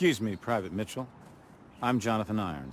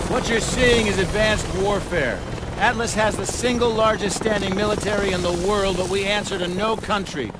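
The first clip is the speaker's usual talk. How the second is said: loud, raised presentation voice addressing a crowd; commanding keynote speech, louder and higher; formal sales pitch; 12 semitones higher, 7 dB louder than usual. Acted. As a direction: project it like a CEO showing off his army. CEO presents Atlas to recruit.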